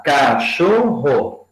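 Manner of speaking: slow, syllables pulled apart; slow syllable-count demo. Shown as a demonstration. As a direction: count out cachorro slowly. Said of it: "três sílabas".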